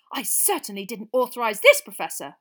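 loud, huffy self-defence; indignant. A narrator's reading. Percy defending himself to the Professor, indignant. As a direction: protest loudly, puffed up with importance. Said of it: "puffing himself up indignantly".

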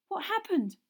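high, worried question; alarmed, calling into the dark; anxious. A narrator's reading. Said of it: "said Hermione anxiously".

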